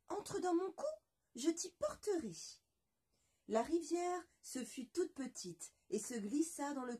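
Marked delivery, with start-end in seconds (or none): 0.1-2.3 high, kindly little voice; half-chick's offer. Acted. La moitié de poulet invite le loup, voix aiguë. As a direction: invite him in generously.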